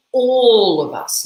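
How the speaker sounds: "all" drawn out high and loud; weighting on ALL. Shown as a demonstration. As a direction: stretch and swell "all". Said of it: "Other weighting that he uses".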